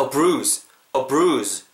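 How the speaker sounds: weak "a", stress on "bruise"; unstressed article. Shown as a demonstration. Teacher showing article stress, "a bruise" weighted on "bruise". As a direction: lean on "bruise", barely touch the "a". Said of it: "관사에는 강세가 안 들어갑니다".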